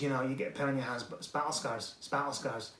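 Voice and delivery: quiet, unintentionally Welsh impression; quoting someone in a botched Welsh accent; attempted Welsh accent. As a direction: slip into a soft Welsh lilt. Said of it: "he's Welsh all of a sudden".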